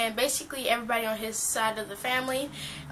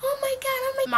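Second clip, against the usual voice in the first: high-pitched imitation of a shocked sister; mock shock; 14 semitones higher than usual. Sister seeing the bloody tooth accident, mock-shocked. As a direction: squeal it as if imitating someone in shock. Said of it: "in shock. She's like".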